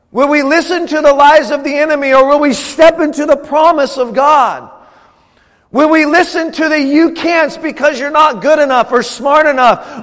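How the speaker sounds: rally the congregation with rising fire; full-force preaching. Acted.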